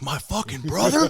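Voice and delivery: high, loud, slow incredulous question; hammed-up astonishment; retold disbelief. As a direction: draw it out, rising in outraged shock. Retold shock at brother's arrival.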